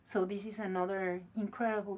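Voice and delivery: ordinary talk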